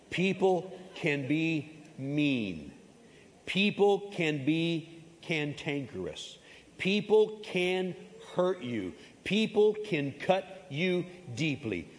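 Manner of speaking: slow, weighty sermon cadence; slow preaching. Acted. Pastor slowly warning the church that people hurt. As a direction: lay each line down slowly for the congregation.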